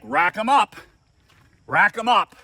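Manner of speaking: mildly mock-commanding call to the room. Retold star calls out to players.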